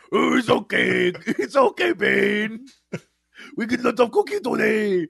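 hammed-up monster reassurance; comic creature voice, shouting at the end; Cookie Monster. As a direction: play a gleeful cookie-mad creature. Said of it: "Bane doing the Cookie Monster".

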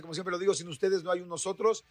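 ordinary talk, nothing marked

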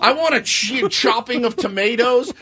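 high, animated mock client directions; lightly mocked excited ad brief; demanding client copy. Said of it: "they would put in the copy, like".

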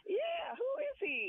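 high incredulous outburst; mock disbelief at the radio voice; retold astonishment. Caller retelling first hearing the host, high mock disbelief. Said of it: "try to explain".

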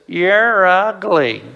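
very slow, drawn-out parrot voice; talking parrot. Acted. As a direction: stretch each word out like a taunting parrot. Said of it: "the parrot says".

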